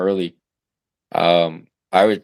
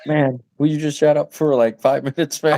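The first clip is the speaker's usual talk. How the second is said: higher-pitched, mock fed-up dad; exasperated plea; 7 semitones higher than usual. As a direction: plead with crying kids, half laughing. Dad retelling plea to crying kids.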